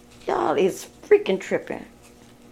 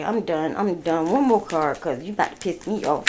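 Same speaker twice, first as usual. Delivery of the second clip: normal talking voice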